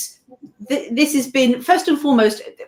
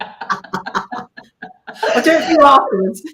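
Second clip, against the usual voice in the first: higher, laughing concession; 6 semitones higher, 3 dB louder than usual. Real, not acted. Guest teased about her accent promises it later, laughing. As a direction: promise it through a fit of laughter.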